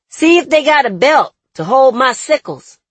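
called loudly to another room; calling out. A narrator's reading. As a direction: call it out across the room. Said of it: "I call".

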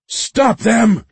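high, loud frantic order; shouting. A narrator's reading. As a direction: shout the order at full urgency. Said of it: "Jordan shouted".

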